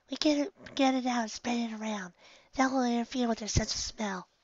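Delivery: high, soft mare voice, practical; Firefly's voice. Acted. Firefly explaining the pepper trick, high and soft. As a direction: explain the plan as a calm young mare.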